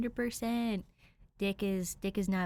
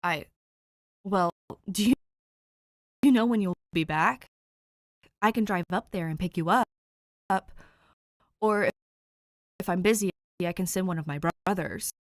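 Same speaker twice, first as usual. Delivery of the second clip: loud, rushed and flustered; flustered rambling; 6 dB louder, faster than usual. Acted. The guy on the phone, nervously offering his sister a ride, flustered. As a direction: stumble over the offer, eager and nervous.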